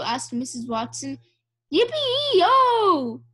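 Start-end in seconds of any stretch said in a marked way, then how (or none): 1.7-3.2 high, loud, drawn-out yell; cowboy whoop. A narrator's reading. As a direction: holler like a riding cowboy. Cowboy's shout heard from outside.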